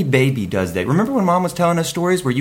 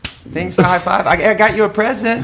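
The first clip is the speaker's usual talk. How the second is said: put-on child voice; 4 semitones higher, slower than usual. Six-year-old kid character talking.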